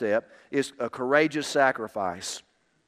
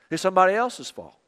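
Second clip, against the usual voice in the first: ordinary talk, nothing marked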